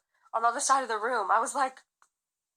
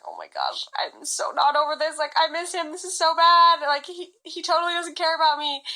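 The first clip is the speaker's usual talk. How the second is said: higher, rising distress, louder on "so bad"; retold panic; 6 semitones higher, 4 dB louder than usual. Back in LA, realizing she misses him, higher and distraught. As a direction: spiral into panic about him. Panicking about not being over.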